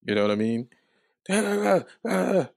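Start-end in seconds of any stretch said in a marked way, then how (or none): 1.3-2.5 mock yelling. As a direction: imitate cops yelling in gibberish. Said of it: "And they yelling".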